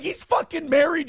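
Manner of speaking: outraged. Retold or imitated. Outraged reply in retold talk.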